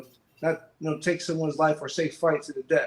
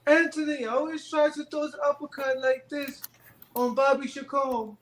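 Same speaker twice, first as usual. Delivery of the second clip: imitating Boza; 10 semitones higher than usual. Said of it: "he was like".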